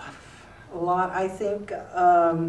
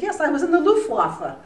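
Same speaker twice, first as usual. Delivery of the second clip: lightly imitated German accent, higher and louder; 10 semitones higher, 7 dB louder than usual. Former Luftwaffe pilot answering the reporter, light German accent. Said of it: "In this German accent".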